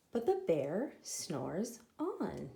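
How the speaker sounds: ordinary delivery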